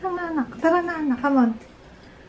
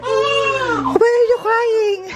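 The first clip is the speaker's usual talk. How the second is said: high, drawn-out parental question; 8 semitones higher, much slower than usual. Real, not acted. Mother to her crying child, high concerned coo.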